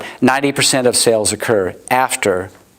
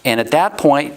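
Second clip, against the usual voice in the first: ordinary talk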